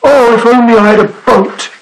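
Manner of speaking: high, wishful exclamation; cried out. A narrator's reading. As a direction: lament it aloud as the Snorg. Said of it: "the Snorg cried".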